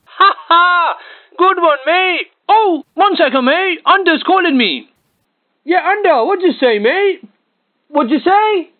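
lightly hammed-up chat to a mate; acting on the phone. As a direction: fake being called away mid-call. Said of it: "It'd go a little something like this".

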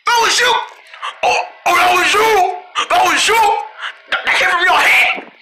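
shrill outraged yell; high accusing shout. Acted. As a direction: accuse them at full volume. Angry accusation at the pranksters.

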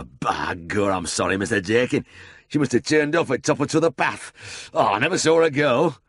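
a hint of a flustered drover; apologetic drover. A narrator's reading. Drover apologizes to the farmer, flustered.

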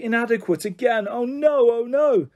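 high, plaintive lament; sad dismay. A narrator's reading. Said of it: "said Diona sadly".

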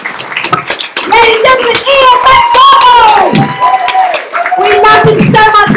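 high, slow drawn-out compere announce; announcer introduction. Acted. Compere bringing the comic back on stage, announcer style. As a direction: stretch out the name like a ring announcer.

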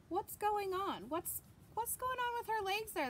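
usual speaking voice

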